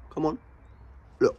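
normal talking voice

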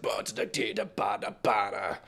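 mock the deep, dominant rap flow; deep dominant rapper voice. Imitating the character's deep dominant rap, scatted low. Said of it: "this deep with his voice".

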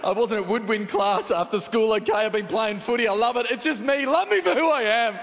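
mock teen confession. Imagined son confessing to mum.

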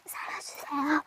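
breathless whispered plea for help; mock-dying whisper; exhausted plea. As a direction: beg viewers faintly, out of breath. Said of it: "목이 타는 그런 느낌이에요".

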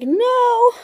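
very high, drawn-out mock wail; dismayed no. Retelling her answer about the spare key set, high wailed no. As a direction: replay it as a high whine of dismay.

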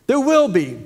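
emphatic repeat. Acted. Repeats the punchline, louder.

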